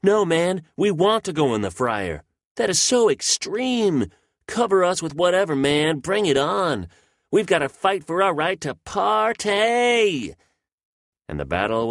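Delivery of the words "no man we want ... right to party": high, loud slacker dude yelling; young hoodlum potato. Acted. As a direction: squeal it out like a rowdy teen who wants to party. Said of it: "some young hoodlum potato screams".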